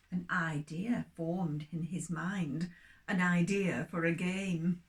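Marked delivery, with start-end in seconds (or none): none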